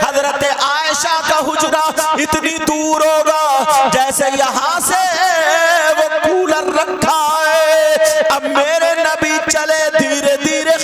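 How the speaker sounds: loud, chanted sermon storytelling; preaching at full force. Acted. Preacher to the congregation, telling the Prophet's story, fervent chanting preaching. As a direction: preach it with swelling fervour, half sung. Impassioned sermon over music.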